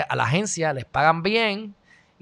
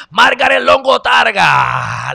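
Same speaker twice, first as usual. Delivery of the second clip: grand announcer call; 3 semitones higher, 7 dB louder, much slower than usual. Acted. Announcing viewer name grandly.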